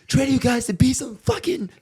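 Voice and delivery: loud, high-pitched coach tirade; imitated full-volume locker-room rant; irate coach. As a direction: bellow like a coach chewing out the team. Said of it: "our coach put us in ... He was like".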